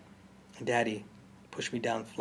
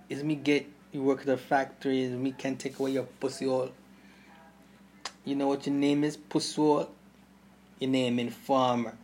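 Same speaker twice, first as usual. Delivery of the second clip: menacing gangster; 3 semitones higher, 4 dB louder than usual. Acted.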